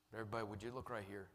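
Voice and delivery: soft, low closing appeal; quiet pastoral invitation, nearly whispered at times; hushed altar call. Real, not acted. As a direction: drop to an intimate, sincere hush.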